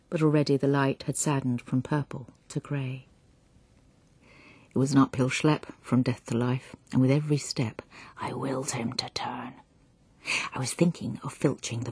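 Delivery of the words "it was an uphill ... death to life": ordinary delivery, nothing marked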